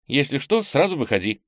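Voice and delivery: высокий голос обеспокоенной сестры; предупреди повыше, с лёгкой тревогой; встревоженно. A narrator's reading. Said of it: "встревожилась Ира". (с ноткой тревоги, высоко)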